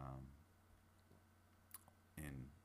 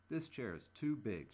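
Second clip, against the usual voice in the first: exclaimed; 7 semitones higher, 6 dB louder, slower than usual. Acted. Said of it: "she exclaimed".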